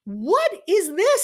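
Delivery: high urgent stop, mock alarm; alarmed interruption. Coach cutting off a stiff presenter, high and incredulous. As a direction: halt him mid-sentence in disbelief.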